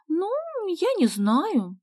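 high, slow, drawn-out hesitation; pondering. A narrator's reading. Sonya weighing Vasya's invitation, thoughtful and drawn out. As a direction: stretch it coyly while thinking. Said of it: "задумалась Соня".

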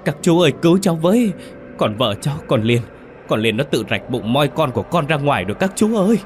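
tearful crying out. A narrator's reading. Husband begging his uncles to save his wife, tearful wail. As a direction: plead wailingly, pitch up and voice near tears. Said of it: "mếu máo kêu lên".

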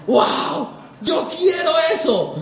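hammed-up high, drawn-out wow; awed listener. Listener dazzled by the cassette speakers, exaggerated awe. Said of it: "y uno decía".

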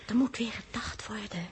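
zachte fluistering; fluisteren. Acted. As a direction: fluister het zodat niemand stoort. Stilte vragen om te denken. (fluistert tegen het vogeltje dat het stil moet zijn)

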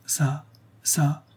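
unvoiced, whispery repeated demand; idiot old woman. A narrator's reading. Idiot woman grabbing at the ruby, whispered craving.

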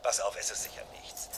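hushed warning so no one overhears; tense whisper. Acted. Secret warning over the phone.

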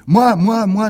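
high, loud eager cries of me; children shouting. Acted. Pupils clamoring to speak into the microphone, shouting. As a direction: shout to be picked first. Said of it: "nous avons tous crié".

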